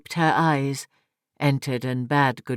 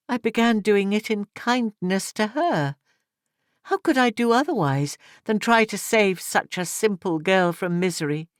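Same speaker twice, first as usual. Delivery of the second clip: higher-voiced young woman justifying herself; Edith pleading; 6 semitones higher than usual. A narrator's reading. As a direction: lift into Edith's earnest plea. Narrator voicing Edith.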